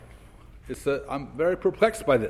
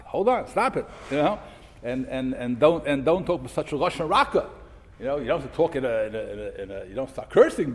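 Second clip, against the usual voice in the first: normal talking voice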